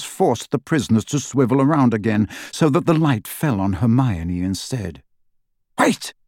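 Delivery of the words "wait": sharp, much higher cry. A narrator's reading. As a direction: snap out a sudden stop. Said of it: "said Narcissa sharply".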